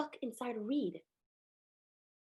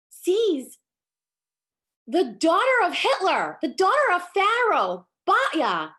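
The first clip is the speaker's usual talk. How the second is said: high, loud sermon build; rising, much louder preacher's fervor; fiery preaching; 4 semitones higher, 7 dB louder than usual. Acted. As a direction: drive it home to the congregation. Preaches the story at full force.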